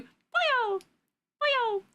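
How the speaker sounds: high-pitched goober noise; high, cartoonish little call; funny little guy. Shown as a demonstration. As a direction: pipe it up like a funny little critter. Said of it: "mimicking the noises of goobers and funny little guys".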